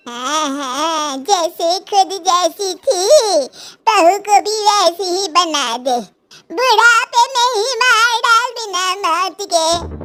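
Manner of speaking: put-on slow, drawn-out old woman's complaint; a weary dadi scolding her bahu; slow lament of an old woman; grumbling old grandma. A narrator's reading. Grandma retorts to saas.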